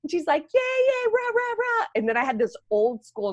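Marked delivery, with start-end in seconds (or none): 0.5-1.8 high-pitched mock cheerleader chant; cutesy cheering. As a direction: parody a relentlessly peppy nurse. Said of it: "like, super cutesy".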